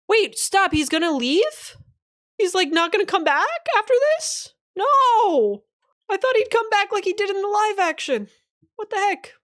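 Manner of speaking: high-pitched, whiny shock; playful distraught outcry; dismayed disbelief. Real, not acted. Dismayed at a chat spoiler.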